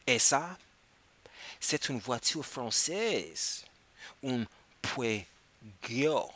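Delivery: very slow and clear, each word set apart; slow model phrase. Shown as a demonstration. Teacher modelling French for students, very slow. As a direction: drag it out word by word for the class. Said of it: "teacher model phrase".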